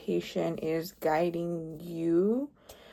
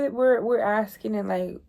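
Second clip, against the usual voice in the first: ordinary talk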